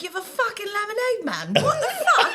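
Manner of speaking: husband fed up with lemonade, mock exasperated. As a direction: toss it off in disbelief. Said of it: "he was like".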